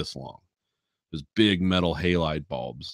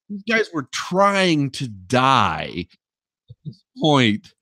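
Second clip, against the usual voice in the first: ordinary talk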